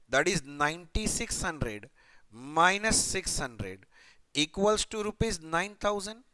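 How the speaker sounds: dictates the sum slowly, word by word; slow dictation. Shown as a demonstration. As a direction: spell the calculation out for note-taking. Dictating calculation. (गणना धीरे-धीरे लिखवाते हुए)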